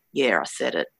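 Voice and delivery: lightly imitated male voice, pitched low; male spirit voice. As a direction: drop low for a man's brief admission. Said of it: "you hear a male saying".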